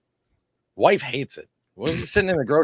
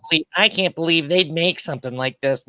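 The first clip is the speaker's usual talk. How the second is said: a lightly voiced, higher gullible viewer; mock the naive commenter; 5 semitones higher than usual. Mocking gullible commenters who think it's real, lightly voiced. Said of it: "people that think it".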